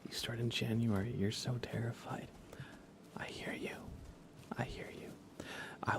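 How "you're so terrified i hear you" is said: ordinary talk, nothing marked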